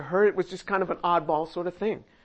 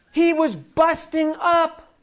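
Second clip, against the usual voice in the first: higher, louder, slowed as if cracking up; mock amazed laughter; 8 semitones higher, 4 dB louder, slower than usual. Preacher acting Abraham falling over laughing, high and loud. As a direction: paint him doubled over laughing.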